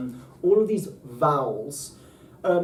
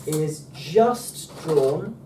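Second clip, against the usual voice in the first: "is just drawn" stretched very slowly while drawing; 3 semitones higher, 5 dB louder, much slower than usual. Shown as a demonstration. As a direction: drag out "is just drawn" word by word. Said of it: "it's hard to do it like this".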